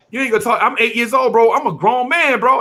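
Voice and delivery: put-on high, loud boast of an eight-year-old; defiant kid. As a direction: puff up like a little kid playing tough. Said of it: "I'm like".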